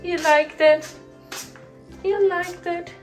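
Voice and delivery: high, cooing little question; pet talk. Real, not acted. Asking the dog fondly.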